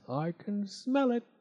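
a hint of a greedy toad; sly toad. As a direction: savour the smell of honey. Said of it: "the smiling Mister Jackson".

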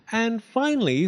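put-on little girl's big finale; grand announcement. A narrator's reading. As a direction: present it like a proud ringmaster. Proud reveal.

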